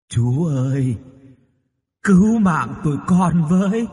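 haunting cry for help; little ghost's plea. A narrator's reading. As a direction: plead as a little spirit, rising to a loud cry. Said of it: "Bên tai thì có thấp giọng thủ thỉ".

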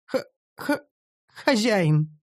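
put-on stammered, strained elf girl; forced out. Elf girl forced by slave magic to say master, stammering. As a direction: choke the word out. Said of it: "С трудом выдавила та".

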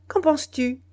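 sudden loud high question; abrupt demand. A narrator's reading. Biassou rounding on the citizen, abrupt and loud. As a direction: fire the question at him without warning. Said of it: "s'adressant brusquement".

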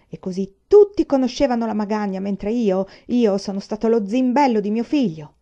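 loud, wounded furious complaint; furious rant. A narrator's reading. Father raging to the family that he was fooled, furious. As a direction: rage at being made a fool.

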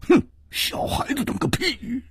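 high, scornful outburst after a huff; scornful snort. A narrator's reading. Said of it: "忍不住哼了一声说".

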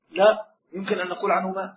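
ordinary talk, nothing marked

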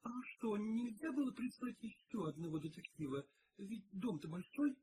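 put-on high-pitched, tentative young maid; timid. A narrator's reading. Maid asks for another detective, timid. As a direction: ask shyly in a high voice. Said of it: "робко проговорила она".

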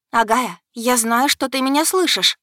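loud, high call to the sky; shouted. A narrator's reading. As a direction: shout up at the goddess. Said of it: "вскинула голову и закричала". (громкий крик в небо)

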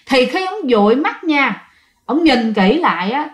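ordinary delivery

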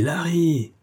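angry host shouting for the butler; hot-tempered shout. Acted. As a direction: yell Larry! across the room. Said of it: "cria le bouillant amphitryon".

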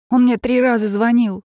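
high, loud blurting; excited outburst. Acted. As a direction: blurt it out all at once. Sister bursts out on phone.

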